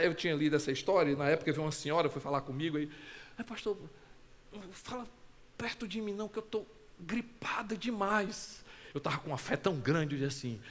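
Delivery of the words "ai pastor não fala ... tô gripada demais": soft, breathy plea, dropping to a whisper at the end; a congregant's faint whispered warning; hushed sick woman. Retold or imitated. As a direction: keep your distance, voice almost gone. Sick woman asks pastor keep away.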